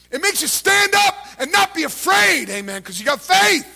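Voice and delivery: loud, high preacher shout that pushes the crowd to answer; full-force preaching. Acted. Preacher rousing the congregation to shout back, loud full-force preaching. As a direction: drive it home like a revival preacher.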